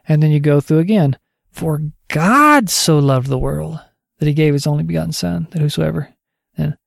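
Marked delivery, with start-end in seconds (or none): 1.5-6.1 "God" hit hardest, the verse kept even around it; stress on God. Shown as a demonstration. Teacher's second pass through John 3:16, stressing "God". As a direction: land the weight on "God" and read on. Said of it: "you just emphasize a different word".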